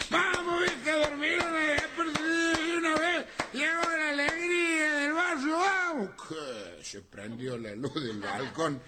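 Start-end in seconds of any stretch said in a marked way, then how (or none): 0.1-6.1 put-on high bellowing drunk yell; drunken shout at four in the morning. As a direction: holler up at the house like a drunk. Drunk calling outside house.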